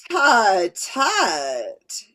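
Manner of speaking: loud tutting alien overlord; echoing metallic voice. Shown as a demonstration. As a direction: scold like a metallic octopus overlord. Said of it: "she said in her echoing metallic voice".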